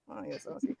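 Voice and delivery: unvoiced, hushed mock reply; whispered aside. Answering her co-host's comment in a whisper. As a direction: breathe it as a secret aside. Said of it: "I'm like".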